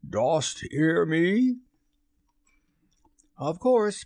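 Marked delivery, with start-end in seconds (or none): 0.1-1.6 high, slow call into the dark; pitched-up, rising summons; raised, quivering voice. A narrator's reading. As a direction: call out uneasily, drawn out. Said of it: "Hook raised his voice, but there was a quiver in it".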